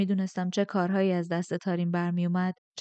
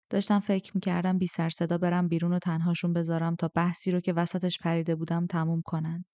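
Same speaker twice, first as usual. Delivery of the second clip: ordinary delivery, nothing marked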